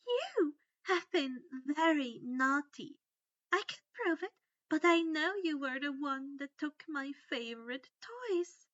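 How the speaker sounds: high, sugary scolding; sweet mock-scolding like a child; Umbridge voice.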